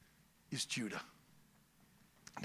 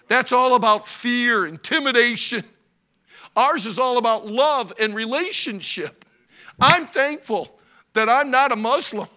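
full-force preaching; 7 semitones higher, 10 dB louder than usual. Acted. Preacher proclaiming God's lordship loudly. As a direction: preach it out to the congregation.

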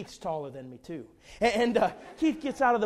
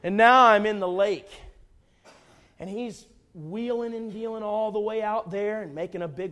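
ordinary talk, nothing marked

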